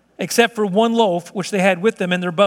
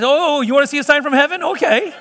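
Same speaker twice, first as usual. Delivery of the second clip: higher-pitched, playful comeback; mock indignation; 6 semitones higher than usual. Preacher imagining himself as God answering doubters, mock indignation. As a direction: play at being provoked.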